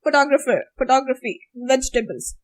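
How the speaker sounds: one stressed syllable each in "Photographer, photography, vegetables"; English-style stress. Shown as a demonstration. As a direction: say fuh-TOG for "Photographer, photography", VEJ-tuh-bulz for "vegetables". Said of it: "not stressing on all the vowels".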